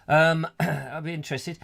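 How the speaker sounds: ordinary talk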